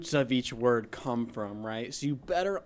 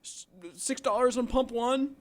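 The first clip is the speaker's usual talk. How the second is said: panicked cry; 10 semitones higher than usual. Retold or imitated. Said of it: "and then he's like".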